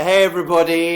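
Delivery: usual speaking voice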